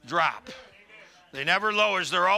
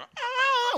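surprised ah. Retold or imitated. Surprise at seeing only old people.

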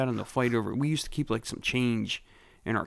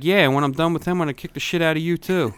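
louder, higher tough-guy threat, fast; retold threat; 5 semitones higher, 6 dB louder than usual. Retold threat to the kid joining the fight, loud. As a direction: warn the kid he's next.

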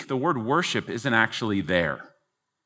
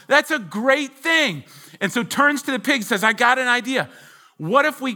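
high, bright-eyed chicken voice, much higher; eager chicken; 10 semitones higher, 4 dB louder than usual. Retold or imitated. Preacher voicing the chicken to the congregation, high and eager. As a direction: play the eager chicken pitching a breakfast idea.

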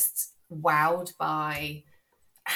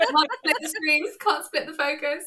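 high-pitched joke; 11 semitones higher, 4 dB louder than usual. Acted. Joking high exclamation.

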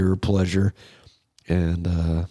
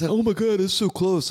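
mock panicked voice; 10 semitones higher than usual. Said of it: "I'm like".

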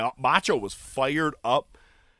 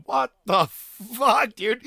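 mock disbelief; 8 semitones higher, much slower than usual. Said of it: "I go".

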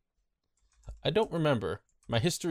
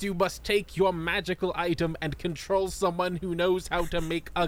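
higher, grandly instructing villain; Merrick; 4 semitones higher than usual. Acted. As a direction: declaim the recipe step theatrically. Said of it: "Welcome to Merrick's Kitchen".

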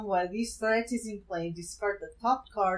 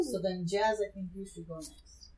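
usual speaking voice

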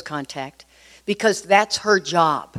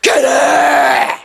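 loud, high roaring growl; Incredible Hulk; 6 semitones higher, 7 dB louder than usual. Retold or imitated. Husband leaps out of his chair at wife, Hulk roar. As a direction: roar like a monster leaping from a chair. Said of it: "like the Incredible Hulk".

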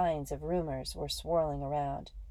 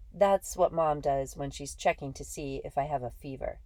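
plain reading voice

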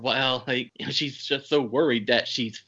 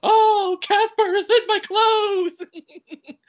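much higher and louder, slow hammed-up wail, laughing through it; mock panicked yell; 8 dB louder, much slower than usual. Buddy mocking Dave during the fight, hammed-up panicked yell. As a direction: shout it like a spooked fool. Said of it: "Buddy's going".

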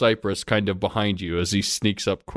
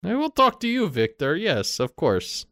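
Nadia, high and eager; 9 semitones higher than usual. Acted. GM voices Nadia.

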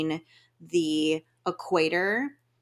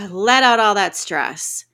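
louder release cue; 3 semitones higher, 8 dB louder than usual. Shown as a demonstration. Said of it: "When you breathe out".

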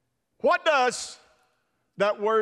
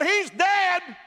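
high, loud blaming voice; Martha's accusation; 8 semitones higher, 4 dB louder than usual. Acted. Martha accuses Jesus at the tomb, high loud blame. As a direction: throw the blame at him, pitched high. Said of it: "'cause she said".

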